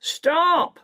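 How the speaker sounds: very high, drawn shout. A narrator's reading. Second smuggler halting the running boy, shouting. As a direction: shout a high command to halt. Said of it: "Mac shouts".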